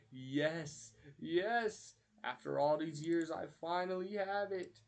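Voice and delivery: hammed-up villain victory cry; Plankton. He finally receives the secret formula, hammed-up triumph. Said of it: "And then he goes".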